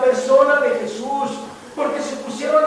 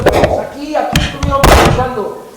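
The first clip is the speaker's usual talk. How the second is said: louder, pointed insistence; emphatic exclamation; 2 semitones higher, 8 dB louder than usual. Real, not acted. Pastor insisting to his congregation, loudly. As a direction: stress it to the pews.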